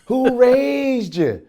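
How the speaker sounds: high, slow, drawn-out scolding; mock indignant scold. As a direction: stretch each word like an outraged auntie.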